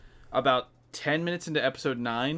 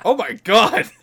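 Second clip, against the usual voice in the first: retold shock; 8 semitones higher, 8 dB louder than usual. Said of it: "I went".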